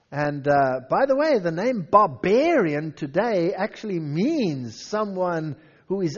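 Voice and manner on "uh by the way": ordinary talk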